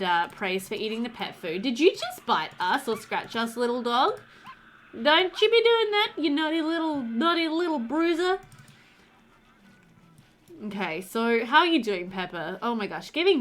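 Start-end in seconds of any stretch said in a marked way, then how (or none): 4.9-8.4 high, cutesy telling-off; mock scolding. Player telling off naughty little Bruiser for biting, mock scolding. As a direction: scold a puppy in a pitched-up baby-talk voice.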